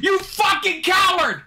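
full shout. Real, not acted. Streamer screaming at his timid character, full furious shout. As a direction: let it rip even harder at the screen.

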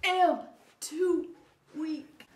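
faint dying gasps; wounded soldier gasping. Acted. Dying melodrama from smell.

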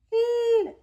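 very high held puppet sound; Osito. Pretend. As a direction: squeal the letter like a toy bear. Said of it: "¿Lo puedes decir, Osito?". (sonido agudo sostenido con voz de osito)

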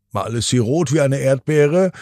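ordinary delivery, nothing marked

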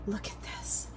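breathy whisper. Real, not acted. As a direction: hush it to a whisper. Hushed look at the food.

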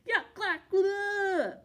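high, loud gagging yuck; disgusted yell. A narrator's reading. Helen choking on strong bug spray, loud gagging yell. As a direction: spit out the bug spray taste. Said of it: "Gave a little spritz and yelled".

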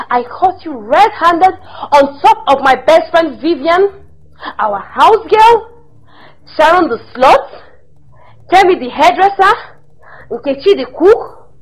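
loud angry listing of his affairs; furious shouted tirade. Acted. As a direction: let him have it, name by name. Wife listing husband's affairs.

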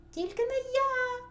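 very high, loud squeak; shouting mouse. A narrator's reading. Second mouse refuses to carry grain, squeaky shout. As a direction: cry out shrilly as a tiny mouse. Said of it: "закричав Верть".

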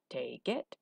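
low, soft gruff reply; blunt hunchback answer; mumbly-simple Igor, low in the throat; low gravelly voice. A narrator's reading. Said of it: "His voice was low and gravelly".

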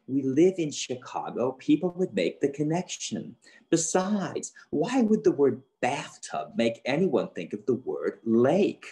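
lightly voiced mom, pressing her point; argumentative. A narrator's reading. Mom arguing with dad over a word game clue, mildly indignant. Mom argues back in story.

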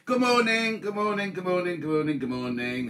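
bright rising good mornings, falling away; sing-song greeting. Real, not acted. Host greeting live viewers in a cheery sing-song. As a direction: call out morning to the room.

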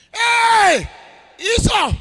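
high, very loud cry of alarm; acted shocked outburst, loud and high; startled shout. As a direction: cry out as the shocked father in the story. Acts out father's shock at Esau.

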